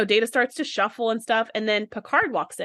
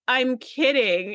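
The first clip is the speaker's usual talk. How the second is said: teasing; 2 semitones higher, much slower than usual. Retold or imitated. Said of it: "And Riker's like".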